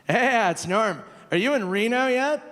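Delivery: a hint of Norm checking in; Norm impression. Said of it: "He calls me up".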